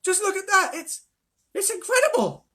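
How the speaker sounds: put-on high, loud thrilled boy; boy shouting at the magic; excited shout. A narrator's reading. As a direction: shout it with wide-eyed wonder. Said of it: "he was shouting".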